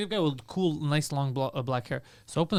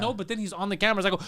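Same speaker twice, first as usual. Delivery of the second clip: normal talking voice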